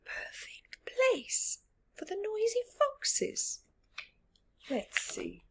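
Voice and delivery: whisper. Real, not acted. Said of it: "whispered aside to child".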